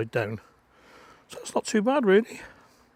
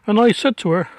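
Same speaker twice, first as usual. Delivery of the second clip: ordinary talk, nothing marked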